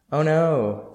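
exaggerated fake shock; mock surprise. A narrator's reading. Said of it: "in mock surprise".

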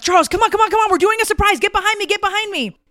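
high, loud, eager hurry-up; urgent excited beckoning; mother hurrying the trainer behind her, excited; excited urging. Retold or imitated. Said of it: "And I'm like".

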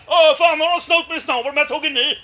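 high, loud re-enactment; excited shouting in a friend's role; agitated retold outburst. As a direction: blurt it out loudly, all worked up. Acts out Martin's excited complaint.

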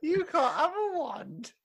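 high and laughing, voice cracking; mock the rule through laughter; laughing high mockery. Mocking the wizards' rule.

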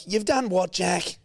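high-pitched, incredulous scolding; imitating alarmed mum. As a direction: play a mum who can't believe what she's hearing. Mum reacts to chainsaw news.

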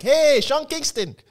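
high, hollering call across the street. Retold or imitated. A group of guys calling to a passerby, high holler. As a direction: holler it at someone walking past, high.

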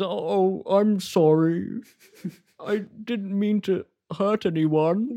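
high, sorry little bear voice; high, slow, sheepish apology; forlorn bear. Acted. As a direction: apologise as a crestfallen stuffed bear. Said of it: "The bear's head hangs forlornly".

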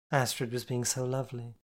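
plain reading voice

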